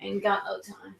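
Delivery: a hint of Nina, dropped soft and low; Nina impression. Teasing cohost by quoting Nina, a soft hint of her voice. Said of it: "don't do Nina on me".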